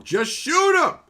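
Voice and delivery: high, full-force yell at the screen; frustrated yelling. Retold or imitated. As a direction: scream orders at a useless anime hero. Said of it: "I was yelling".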